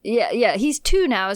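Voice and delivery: ordinary talk, nothing marked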